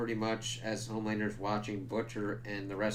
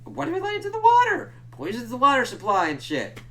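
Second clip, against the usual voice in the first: high, loud, disbelieving squeal; high-pitched rant at a dumb plot choice; incredulous outburst; 14 semitones higher, 10 dB louder than usual. Real, not acted. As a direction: yelp it like you can't believe it. Ranting about poisoned water.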